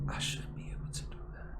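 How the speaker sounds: a frustrated private whisper; whispered to self. Real, not acted. Puzzling over posting the live to YouTube, whispered. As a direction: whisper it under your breath. Muttering to herself.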